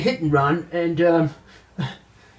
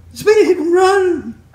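much higher, louder shrieks of fake shock; mock horror; 13 semitones higher, 7 dB louder than usual. Anchor watches crash footage, shrieking in mock horror. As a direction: shriek at the footage, half laughing.

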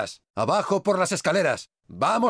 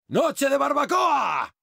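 high, drawn-out shout; gleeful war cry; 8 semitones higher, slower than usual. Acted. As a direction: holler it slowly like a party announcement. Gleeful cry while burning enemies.

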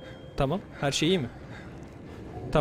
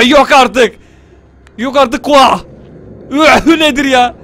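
louder, laughing shout of disbelief; 2 semitones higher, 10 dB louder, much slower than usual. Real, not acted. Player reacting to something absurd, loud disbelief. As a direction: shout it like you can't believe it.

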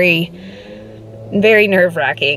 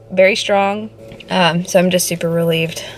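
ordinary delivery

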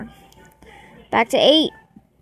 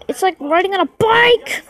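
raised pitch, jumping up on "bike"; exasperated exclamation; 5 semitones higher than usual. Real, not acted. Player complaining about balancing the ball, exasperated exclamation. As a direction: exclaim it, punching the last word.

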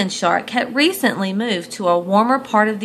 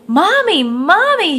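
high, loud call for mom; little shark calling his mother; 9 semitones higher, 7 dB louder than usual. A narrator's reading. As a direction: call out eagerly across the water. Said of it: "he called out".

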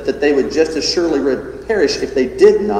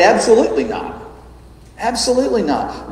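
"Absolutely" stressed high; insist, twice over; emphatic denial; 7 semitones higher than usual. Retold or imitated. Preacher paraphrasing Jesus' firm no, high and insistent. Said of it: "We'd probably say".

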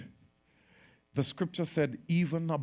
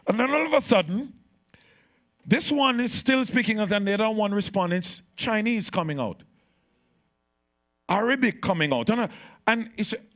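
higher and louder, fast fervent preaching; excited preacher retelling Babel, fast; preaching at full force; 9 semitones higher, 7 dB louder than usual. Acted. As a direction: drive the story home to the congregation.

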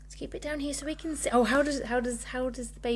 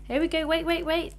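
ordinary talk

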